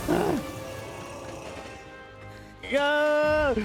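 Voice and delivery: high excited yelp; triumphant shriek of relief. Real, not acted. Tense jump succeeds in game.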